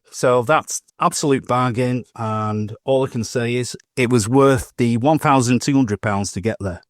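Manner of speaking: ordinary talk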